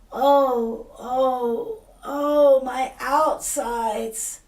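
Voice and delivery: loud, high, drawn-out slow groans; anguished wail. Acted. Ereshkigal in labor to the flies, loud slow wail.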